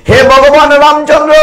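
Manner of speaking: loud devotional call; high loud call. Acted. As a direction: shout the Lord's name high and loud. Same address shouted.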